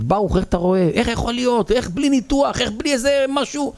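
high, piled-up questions of disbelief; high-pitched amazed townsfolk; incredulous questioning. Acted. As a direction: demand to know how on earth. Townspeople bombard him with questions.

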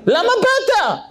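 high, yelling in exasperation; furious impatient yell at the man who took the turn; angry shouting. Retold or imitated. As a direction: shout at him like you've waited three hours. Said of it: "הוא התחיל לצעוק עליו".

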